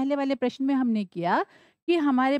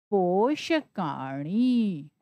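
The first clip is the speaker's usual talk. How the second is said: पोषकाणी stretched very slowly, syllable by syllable; slow reveal of the word; 3 semitones lower, much slower than usual. Shown as a demonstration. Teacher to students joining the letters, very slowly. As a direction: sound out पोषकाणी at a crawl. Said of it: "तो क्या बना शब्द?".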